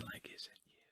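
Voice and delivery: fast intimate whisper. Retold or imitated. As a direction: breathe it close and quick. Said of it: "this is how Jesus talks to him".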